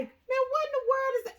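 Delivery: put-on the boyfriend hearing the flood; mock startled. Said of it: "He was like".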